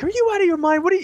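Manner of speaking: high-pitched mock yell, no real volume; imitated yelling. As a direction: imitate an outraged girlfriend yelling down the phone. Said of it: "she's, like, yelling at me".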